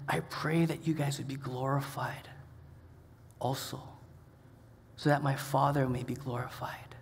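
soft, low, hushed prayer; Jesus praying. Retold or imitated. Jesus praying over the disciples, soft and hushed. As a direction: pray gently over them. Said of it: "he's saying".

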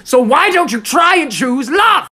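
high, slow full-force shout; aggressive loving shout. Acted. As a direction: yell loving advice at the top of your voice.

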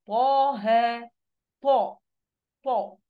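"طه" and "طا" with a heavy, full ط; emphatic ta. Shown as a demonstration. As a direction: push ط out of an air-filled mouth. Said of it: "فبخرجه وهو مليان".

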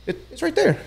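very high-pitched retold insistence; insistent. Angler showing partner the hidden entrance, retold squeaky insistence.

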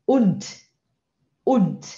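"und" ending in a crisp T sound; D final como T. Shown as a demonstration. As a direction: say "und" as "unt", each one set apart. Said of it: "wird als T ausgesprochen". ("und" com o D final soando como T)

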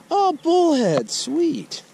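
light up at the catch; delighted surprise. Real, not acted. Angler pulling up a bullhead he wanted, thrilled high cry.

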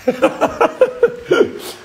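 high breathy burst of laughter; a high giggle under her breath; airy laugh. Real, not acted. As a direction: laugh it out without voice. Caught showing the cigarette pack.

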